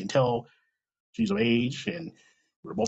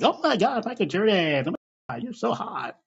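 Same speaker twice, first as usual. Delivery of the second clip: a hint of a squealing fan girl; lightly mock the gushing girls; mock fangirl; 7 semitones higher than usual. Retelling the girls gushing to his friend, mock squeal. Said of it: "blonde ambitions show up and they're like".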